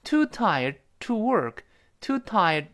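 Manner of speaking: "tired" with the d stopped, not released; "tired" cut off at a silent d; unreleased final d. Shown as a demonstration. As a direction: hold the tongue on the last d of "tired". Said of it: "注意最后那个的失去爆破".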